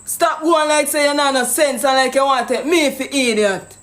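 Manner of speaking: usual speaking voice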